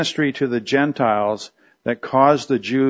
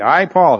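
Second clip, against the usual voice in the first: higher and louder stress on I, Paul; Paul, emphatic; 4 semitones higher, 5 dB louder than usual. Acted. Teacher quoting Paul identifying himself, emphatic. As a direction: announce I, Paul with force. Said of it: "So he's emphatically, uh, identifying himself".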